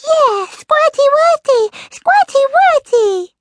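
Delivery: very high, loud, excited squeal; piercingly high squeaky cheer; squeaky little creature. A narrator's reading. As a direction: squeak it out like an overjoyed toddler. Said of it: "squeaked Squeak".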